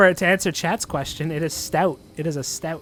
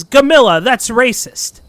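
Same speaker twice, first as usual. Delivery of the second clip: loud, high, scandalized rebuke; indignant call-out; 7 semitones higher, 13 dB louder than usual. Acted. Jorah snaps at Gamilla over the remark, loud and high. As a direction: call her out sharply by name.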